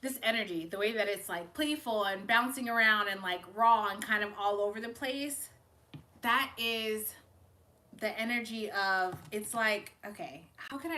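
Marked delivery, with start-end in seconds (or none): none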